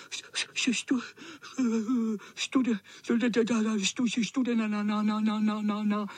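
stuttering shivering cat; teeth chattering. A narrator's reading. Soaked tomcat shivering, teeth chattering. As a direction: chatter out each syllable. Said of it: "Drkotal zuby".

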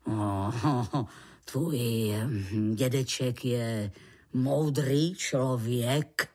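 low, slow, solemn man's voice; serious. A narrator's reading. Man judges creature's grandfather wise, serious. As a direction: pronounce it gravely, with pauses. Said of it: "prohlásil pan Vavřinec vážně".

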